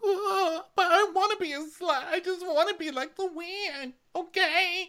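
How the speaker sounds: high, whiny fake sob; mock crying. Ramona pretending to cry about wanting to be Luann. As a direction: blubber like a badly faked cry. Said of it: "doing her pretend crying".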